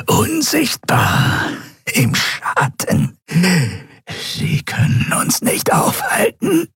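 slow, breathy shadow hiss; eerie hiss. Acted. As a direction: hiss like a voice from the dark. Draxül hisses from the shadows. (langsam gezischt aus dem Schatten)

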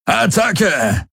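high-pitched charge command; battle cry. A narrator's reading. Warlord sends his warriors through the opening, crying out the charge. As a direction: cry the attack order with a soaring voice. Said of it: "rief Daraios".

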